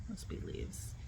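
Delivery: breathy whisper, almost to herself; whispered to self. Real, not acted. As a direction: whisper it under your breath. Repeats to herself, nervous.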